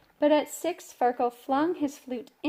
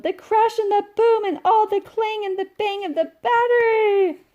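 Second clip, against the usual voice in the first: higher, louder tantrum yell; bellowed; 10 semitones higher, 9 dB louder than usual. Acted. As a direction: scream it in a tantrum. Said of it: "he bellowed".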